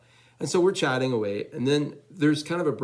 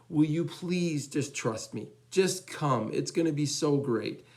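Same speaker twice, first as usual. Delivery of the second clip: drawn-out, higher-pitched plea; imploring a hesitant friend, pitched up; earnest pleading; 4 semitones higher, slower than usual. Retold or imitated. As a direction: coax slowly, leaning on each word.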